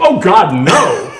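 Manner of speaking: loud mock-horrified outburst; feigned horror. Doctor reacting to talk of cutting back alcohol, loud mock horror. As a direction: cry out in hammed-up dismay. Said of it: "kinda feigns this expression".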